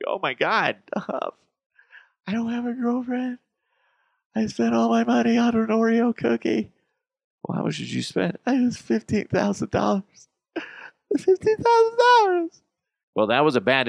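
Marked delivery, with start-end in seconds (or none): 0.1-6.7 high, whiny fake sobbing; mock crying. Buyer whining to host over having no girlfriend, mock crying. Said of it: "crying to me when you don't have a girlfriend". 8.5-10.3 high, strained fake weeping; hammed-up tearful confession; mock crying. Buyer confessing to host what he spent, mock crying. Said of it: "come crying to me". 11.2-12.6 very high, loud fake sob; mock wailing. Buyer bawling about wasted money, hammed-up crying. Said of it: "come crying to me".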